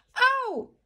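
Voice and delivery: sharp high yelp of pain; cry of pain. Acted. Goldilocks falls off the broken chair, high yelp. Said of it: "strigă ea".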